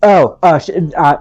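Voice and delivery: ordinary delivery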